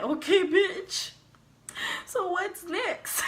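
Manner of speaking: high, slow, cocky mock-tough drawl; sassy defiance. To the booking officer after the flash, a high, slow sassy challenge. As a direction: act unbothered and daring to the booking officer.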